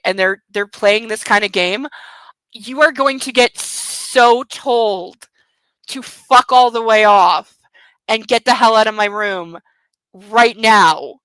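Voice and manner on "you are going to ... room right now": slow, loud, indignant warning; angry outburst. Real, not acted. Reader furious at anyone playing this game with her. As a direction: tell them off for real, punching each word.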